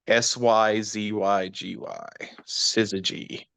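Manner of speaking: slow, deliberate letters, then Syzygy; spelling it out. Shown as a demonstration. Explaining an odd astronomy word to co-hosts, spelled out slowly. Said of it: "is called a syzygy".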